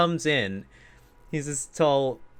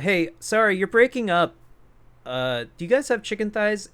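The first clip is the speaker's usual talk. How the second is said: lightly voiced phone customer, pitched up; higher voice of a caller who can't hear; imitating a caller; 7 semitones higher than usual. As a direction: ask again in a raised customer voice. Voices caller on a bad line.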